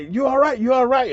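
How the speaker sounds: high, quick questioning, acted out; retold anxious check. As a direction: check on a fallen buddy, voice lifted. Teammates checking on fallen player.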